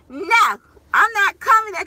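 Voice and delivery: loud, high mock refusal; defiant toddler. Toddler to mom from her shoulders, mock-defiant. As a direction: imitate a stubborn little child digging in.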